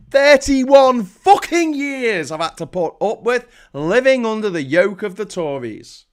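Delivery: real fed-up fury; exasperated outburst. Real, not acted. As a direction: burst out, dragging the words in disbelief. Outburst at years of Tory rule.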